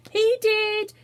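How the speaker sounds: high loud answer; all blurt it out pointing; eager chorus. A narrator's reading. Three children answer together.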